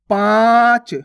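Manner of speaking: पाँच with a long nasalised vowel. Shown as a demonstration. Teacher modelling for learners, loud drawn-out nasal पाँच. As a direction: stretch पाँच loudly through nose and mouth. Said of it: "इसी प्रकार से आपको इसका उच्चारण करना है".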